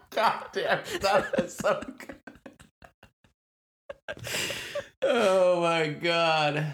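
high, breathless, still laughing; slow, teary laughing recovery. Real, not acted. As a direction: gasp it out through laughter.

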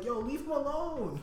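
high, slow, voice cracking plea; voice breaking. Retold or imitated. Man on the train pleading, high cracking voice on the verge of tears. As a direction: plead as if about to cry.

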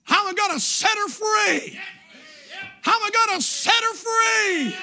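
slow, loud, high Southern drawl shouting. Retold or imitated. Kentucky preacher answers auctioneer in loud Southern drawl. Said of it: "with that southern drawl".

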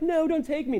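high mock wail of a dragged child; mock crying child. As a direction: whine like a kid being dragged off. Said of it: "I once was crying".

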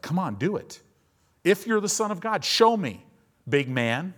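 high, loud, goading tempter; taunting dare. Acted. Satan tempting hungry Jesus, taunting dare.

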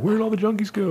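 high, puzzled voice as if lost; mock bewildered question. New Yorkers asking after the junkies, mock puzzled. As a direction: asks where everyone went, baffled. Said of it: "where they're like".

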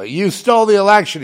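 shouted high and loud accusation; retold yell. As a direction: yell it at the president across the room. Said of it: "yell at Biden".

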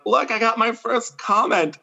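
high, gleeful announcement; proud excitement. Retold or imitated. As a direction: hold it up like a trophy. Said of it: "I was like".

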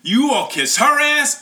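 high, loud retold accusation; imitated yell. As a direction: act out an upset boy hurling an accusation. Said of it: "he's in tears, and he yells".

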